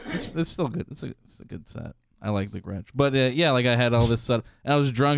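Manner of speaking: normal talking voice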